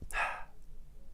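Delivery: soft, breathy resigned sigh; long sigh. Acted. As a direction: let out a weary sigh. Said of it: "Mister Tickle sighed".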